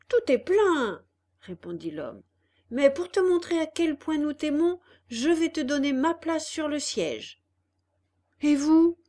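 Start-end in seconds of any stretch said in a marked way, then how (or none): 0.1-1.0 high, loud mock regret; little man. A narrator's reading. Little man telling Pinocchio the cart is full, coaxing. 2.7-7.4 higher, louder, syrupy; sugary little man. A narrator's reading. Little man offering Pinocchio his seat, syrupy. 8.4-9.0 high, loud sudden question; startled he gives up his seat; surprised. A narrator's reading. Pinocchio asking the little man about himself, surprised.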